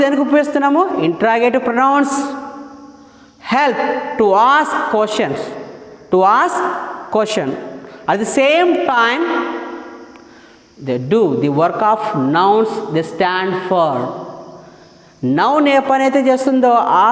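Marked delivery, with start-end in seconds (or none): 3.5-13.9 very slow, word by word definition; slow dictation. Shown as a demonstration. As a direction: dictate it slowly so students can write.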